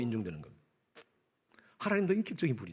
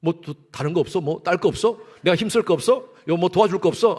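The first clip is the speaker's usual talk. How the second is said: louder, rising eagerness to help; an eager husband asking for more chores; a husband getting keener and louder with each offer; 7 dB louder than usual. Retold or imitated. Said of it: "남편들은 뭐라는 줄 아세요?".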